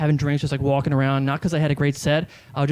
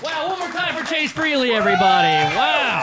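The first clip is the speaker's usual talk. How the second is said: mock emcee hype; 10 semitones higher than usual. Fake host calls applause for Chase Freely, mock emcee hype. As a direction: hype the crowd like a pretend MC. Plays emcee after own sign-off.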